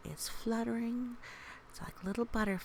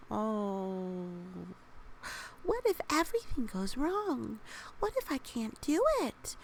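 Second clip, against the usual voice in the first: high, strained worried questions, slowing at the end; anxious inner worry; 6 semitones higher than usual. Acted. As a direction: fret aloud like a frightened little cloud. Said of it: "She thought".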